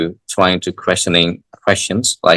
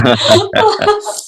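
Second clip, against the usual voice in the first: high laugh. Real, not acted. Laughs at own joke.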